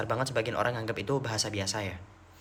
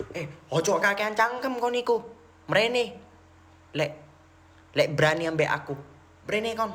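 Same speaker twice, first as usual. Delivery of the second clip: harsh Surabaya Javanese; 7 semitones higher than usual. Retold or imitated. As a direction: snap it like a rough Surabaya student in a quarrel. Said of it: "sampai hafal aku ngomong, ngomong gitu".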